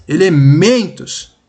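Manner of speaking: ELEMENTOS with MEN punched and stretched; stress on MEN. Shown as a demonstration. Teacher shows the strongest syllable, stressing MEN in ELEMENTOS. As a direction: lean hard on the MEN in ELEMENTOS. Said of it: "a sílaba que você fala mais forte".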